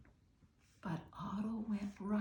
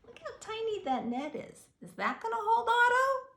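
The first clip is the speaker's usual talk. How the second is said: much higher, wide-eyed question; amazed aside; 13 semitones higher than usual. A narrator's reading. Reader wondering with the children about the net. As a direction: marvel at the tiny net. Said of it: "reader asks children".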